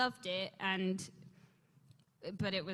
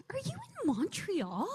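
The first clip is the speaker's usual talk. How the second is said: manager's question; 10 semitones higher, slower than usual. Retold or imitated. Manager voiced on phone.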